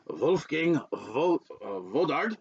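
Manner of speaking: "Wolfgang" with a German V sound, "Vodarg" with a V; German accent. Shown as a demonstration. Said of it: "try to pronounce it in a German accent".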